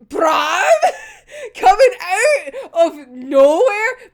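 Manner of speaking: loud, laughing, slowed exclamation; loud delighted laugh; laughing excitement. Real, not acted. Excited about Bram's arrival.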